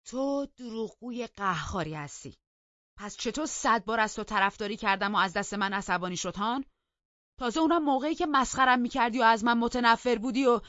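loud, high accusation; heated, indignant self-defence; angry retort. A narrator's reading. Angry retort calling her a liar.

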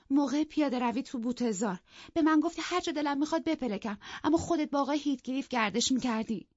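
high-pitched tearful complaint, lightly acted; acted sobbing. A narrator's reading. Isabella accusing her sister-in-law about the walk, mock-sobbing. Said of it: "ایزابلا حق حق کنان گفت".

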